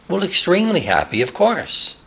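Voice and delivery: high creaky granny reply; old lady voice. Retold or imitated. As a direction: answer like a stiff-backed old lady. Stelta answers.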